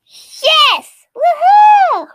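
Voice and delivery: put-on high, loud childish cheer; kid cheering. Entitled kid cheers at being allowed to play, loud high whoop. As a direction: whoop like a thrilled little kid.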